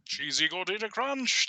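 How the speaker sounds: overdone cartoon Brit, played for laughs; cheesy British accent. Retelling the silly old story in a cheesy British accent. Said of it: "like, a realistic British accent".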